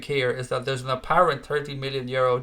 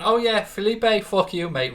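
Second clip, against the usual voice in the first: mocking dismissive voice; 8 semitones higher, 4 dB louder than usual.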